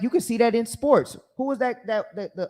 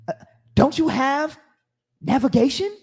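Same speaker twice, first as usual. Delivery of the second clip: condescending passenger; 7 semitones higher, 4 dB louder than usual. Retold or imitated. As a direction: ask it like she's an idiot. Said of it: "you being condescending".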